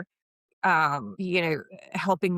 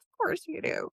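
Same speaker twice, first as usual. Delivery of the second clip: smug aside; 13 semitones higher, 5 dB quieter than usual. Retold or imitated.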